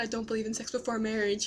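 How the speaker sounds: fast, flat repeat of "I don't believe in sex before marriage"; rattled off fast. Retold or imitated. Recapping her answer to the stranger, said fast.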